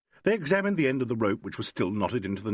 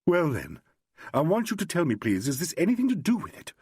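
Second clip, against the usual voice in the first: put-on higher, pressing, insistent young woman; urgent; 7 semitones higher than usual. A narrator's reading. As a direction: push the questions at him urgently. Carolyn voiced by narrator.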